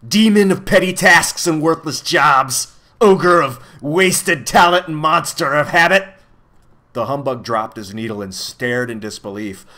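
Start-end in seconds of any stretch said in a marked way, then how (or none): 0.1-6.1 loud, high, grandiose villain announcement; triumphant proclamation. A narrator's reading. The villain reveals himself to Milo, triumphantly proclaiming. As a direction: proclaim each title slowly and grandly. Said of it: "he shrieked triumphantly".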